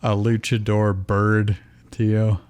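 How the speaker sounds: ordinary delivery, nothing marked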